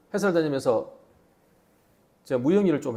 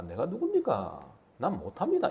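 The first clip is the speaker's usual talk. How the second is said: timid Moses; 3 semitones lower, 8 dB quieter than usual. Acted. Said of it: "뭐라고 합니까?".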